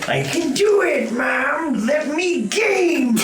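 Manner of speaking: put-on very high, slow childish pleading; baby Pokemon voice. Baby Pokemon begging its mom, very high and slow. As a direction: squeak it out like an eager little kid. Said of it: "baby voiced to its mom".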